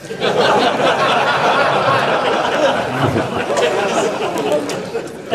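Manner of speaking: high burst of laughter. Real, not acted. Speaker laughs at his grandfather's warning, high laugh.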